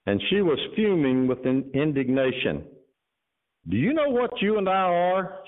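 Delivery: sermon story reading voice; story narration. A narrator's reading. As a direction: read the tale aloud to the congregation. Reads a story to the congregation.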